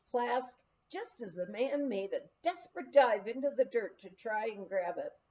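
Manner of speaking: usual speaking voice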